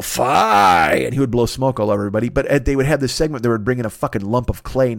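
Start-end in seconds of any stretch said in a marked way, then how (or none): 0.0-0.9 a short mock dragon roar, louder; hammed-up dragon cry; dragon yell. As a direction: bark it out like a puppet dragon. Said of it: "Dirty Dragon would yell".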